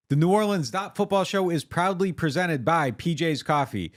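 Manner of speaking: upbeat sponsor read. Acted. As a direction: read it like a radio spot. Host reads sponsor ad.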